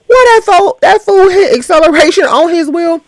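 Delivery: loud, high and amused; high, loud excited commentary; excited outburst. Real, not acted. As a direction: cry out at the wild spinning. Excited reaction to video.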